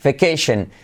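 ordinary talk

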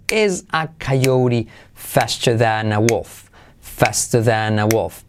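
slow, low, measured model sentence; slow repetition. Shown as a demonstration. Teacher drilling the sentence for learners, slow and deliberate. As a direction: repeat it slowly, stretching each word.

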